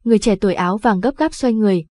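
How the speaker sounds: usual speaking voice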